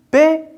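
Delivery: "paix" alone, pitched high; teacher isolating "paix"; isolated second syllable. Shown as a demonstration. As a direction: sound out "paix" as one separate syllable. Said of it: "La deuxième".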